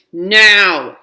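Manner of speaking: loud, drawn-out "now"; mom's yell. A narrator's reading. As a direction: yell it long, like an impatient mom. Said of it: "yells my mom".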